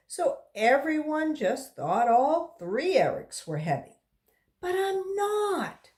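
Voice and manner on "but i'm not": put-on high, slow child's protest; lightly voiced boy insisting he isn't fat; insisting kid. A narrator's reading. Skinny boy protesting to classmates, insistent. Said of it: "Eric Bacon insisted".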